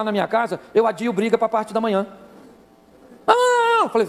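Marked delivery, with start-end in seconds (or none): 3.3-3.9 high-pitched whining no; whiny refusal. Acted. Husband dodging a bedtime argument, high whiny refusal. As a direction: whine it loudly and very high.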